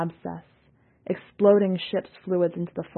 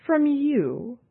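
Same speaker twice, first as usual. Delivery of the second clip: higher, scornful question; mocking; 8 semitones higher than usual. A narrator's reading. Seon scoffing at Yalnis, higher and scornful. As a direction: scoff at the idea. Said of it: "Seon said".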